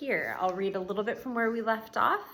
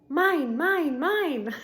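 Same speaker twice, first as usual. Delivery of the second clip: loud, higher, gleeful cry of ownership; joyful claiming; 7 semitones higher, 9 dB louder, much slower than usual. Acted. Gorilla outside at last claiming the world, joyful shout. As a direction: shout it out with pure delight. Ivan finally outside, overjoyed.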